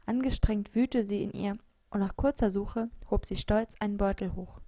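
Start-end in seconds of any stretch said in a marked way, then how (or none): none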